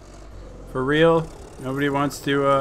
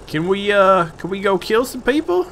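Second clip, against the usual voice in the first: higher, pleading cartoon sidekick rising as it goes; eager tank voice; 8 semitones higher than usual. Tank begging its driver to go fight, higher eager voice. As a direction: beg like an eager little pet. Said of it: "He's like".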